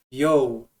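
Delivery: usual speaking voice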